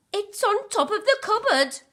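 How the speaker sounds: high, loud excited cry; cried out. A narrator's reading. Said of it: "cried Little Bear".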